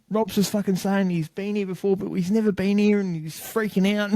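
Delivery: high-pitched, worked-up son impression; retold panicky tattling; agitated explaining. Agitated son explains to his mum.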